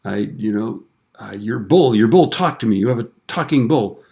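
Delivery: high, loud, stammering disbelief; acted frantic panic. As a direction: play a man freaking out at the farmer. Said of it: "like, going crazy".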